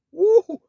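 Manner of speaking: loud high whoop of delight; excited whoop. Real, not acted. Preacher whoops at the definition, loud and high. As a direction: let out a big happy woo.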